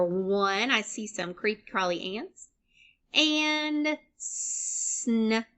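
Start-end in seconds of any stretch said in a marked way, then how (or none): 4.2-5.4 "snail" stretched as S-N, pause, AIL; sound by sound. Shown as a demonstration. Teacher sounding out for learners, "snail" in separated sounds. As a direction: break "snail" apart with a long opening hiss of S. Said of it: "saying all the sounds individually".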